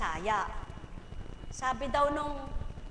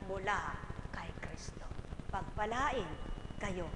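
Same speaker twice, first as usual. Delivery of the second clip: lower, softer closing benediction; soft blessing; 7 semitones lower, 9 dB quieter than usual. Real, not acted. Preacher ends with a soft blessing. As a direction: gently bless them.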